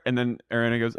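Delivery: normal talking voice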